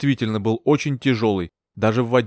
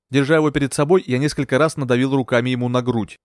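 ordinary delivery, nothing marked

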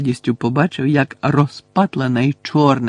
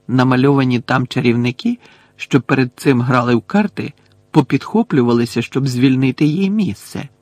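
plain reading voice

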